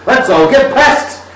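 high, loud, slow let's all get pissed; rowdy party call. Retold or imitated. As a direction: bellow it like a drunk sheriff. Sheriff quoted, party call.

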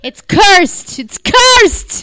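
loud, high playful cry of doom; mock cursed warning. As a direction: cry out a pretend curse, pitch jumping up.

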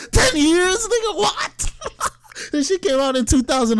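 high-pitched incredulous outburst; laughing disbelief. Real, not acted. Incredulous reply to the lie.

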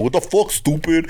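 a hint of the brother calling; mock brother. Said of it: "He's like".

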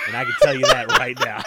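high, quick words breaking into laughter; laughing through the punchline; real laughter. Real, not acted. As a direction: crack up at your own joke as you finish. Cracks up at own joke.

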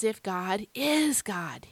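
slow, "is" stretched out between the two "God"s; emphasis on IS. Shown as a demonstration. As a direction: linger heavily on "is". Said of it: "why am I emphasizing this present tense verb".

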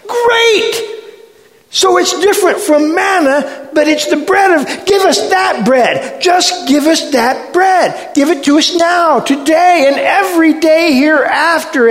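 high, loud clamoring crowd voice; over-excited follower crying out impatiently; eager demand. Acted.

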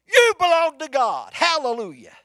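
loud, high joyful declaration; mirror declaration. Pretend. Telling your own reflection, loud and high. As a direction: proclaim it to yourself with fire. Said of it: "walk to your mirror in the morning and say".

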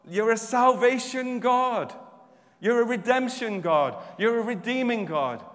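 loud, high, emphatic proclamation; forceful preaching. Real, not acted. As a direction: proclaim it to God with full force.

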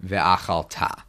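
ve'achalta with the accent landing on the closing "ta"; stress on last syllable. Shown as a demonstration. Teacher points out the verb's accent, final-syllable stress on ve'achalta. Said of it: "the key issue here is, is the accent on the verb".

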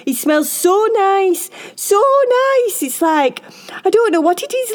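high, loud, gushing puppy-like chatter; excited dog Tucker. A narrator's reading. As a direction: babble eagerly, stretching the keywords with delight.

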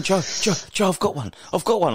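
high, excited calling out to Joe; quoting a mate's excited call to Joe; excited retold call. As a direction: sell the thrill of a bird home, pitched high. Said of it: "He's going".

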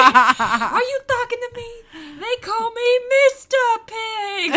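high, slow squeaky cartoon voice, laughing through it; Lion King character voice. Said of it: "because of Lion King".

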